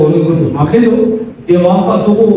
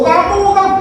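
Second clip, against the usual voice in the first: mock threat. Aggressor confronts listener.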